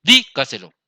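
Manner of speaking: Dígaselo with DÍ punched loud; stress on first syllable. Shown as a demonstration. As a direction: hit the DÍ in Dígaselo, let the rest fall. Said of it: "llevan el acento en la trasantepenúltima".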